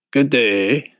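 husky, friendly straw-man greeting; a rough husky voice for the scarecrow. Acted. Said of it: "in a rather husky voice".

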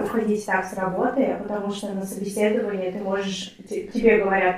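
usual speaking voice

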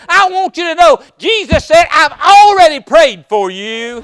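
loud, high preacher's call; preaching at full force. Acted. Preacher pressing the congregation in a loud call. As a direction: drive it home to the congregation.

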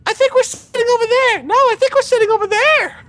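squeaky voice. Retold or imitated. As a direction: bicker in a tiny mouse voice. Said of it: "all you hear is this".

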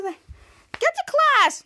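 high, loud, drawn-out order; mock teacher yell. Retelling a teacher yelling the kids to class, mock yell. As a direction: bark it like a cranky teacher.

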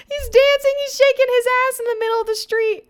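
high, loud excited shout. Real, not acted. Vlogger shouting about a dancing man in the street, excited. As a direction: yell it out, thrilled and amazed.

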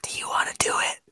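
soft whispered question; whispering. Real, not acted. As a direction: whisper it off mic. Off-mic aside to cohost.

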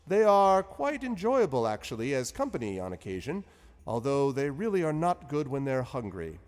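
high, loud, genial wizard voice; lilting, high wizard Zephyros; cheery old wizard. Acted. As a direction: play the jovial wizard host.

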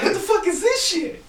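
high-pitched disbelieving cry; sudden startled question; shocked yelp. Retold or imitated. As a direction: squeak it out in shock. Retold shock in the fog.

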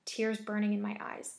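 usual speaking voice

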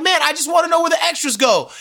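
high, pleading exasperation; extra protesting to the boyfriend, exasperated. Retold or imitated. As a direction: plead your innocence, voice way up. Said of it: "And I'm like".

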